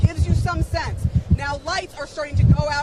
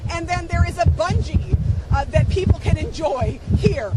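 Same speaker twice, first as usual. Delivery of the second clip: ordinary talk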